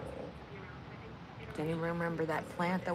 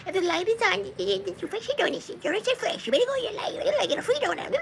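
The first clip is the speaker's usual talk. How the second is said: high squeaky imitation of excited friends; much higher, giddy mock voice; mock hyped gossip; 14 semitones higher than usual. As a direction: squeal it like breathless hype. Mimicking hyped-up friends about donuts.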